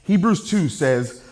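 ordinary talk, nothing marked

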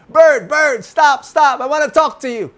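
high, loud shouting horse; horse calling out. A narrator's reading. Horse shouts up at the bird flying overhead. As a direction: call the bird back loudly.

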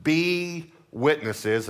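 "Be" stretched very long and pitched high; stress on Be. Shown as a demonstration. Preacher repeats the word to notice, stress on "Be". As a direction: hold "Be" high, then let "witnesses" fall. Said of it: "notice the word be".

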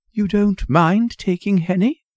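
high-pitched, coaxing mother asking a favour; pleading mother. Acted. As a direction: lift it into a hopeful, polite request. Mother asks narrator a favour.

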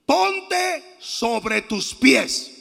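very slow, forceful proclamation; shouted command. Acted. Preacher acts out Paul ordering the lame man, slow shouted command. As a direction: order it out with long, separated words. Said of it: "Le gritó".

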